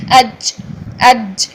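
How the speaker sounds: "اج" with a breathy ch-like release; faulty jeem demo. Shown as a demonstration. Tajweed teacher showing learners a common error, faulty jeem. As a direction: let "اج" end in a ch sound. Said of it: "چے کی sound پیدا ہو جاتی ہے".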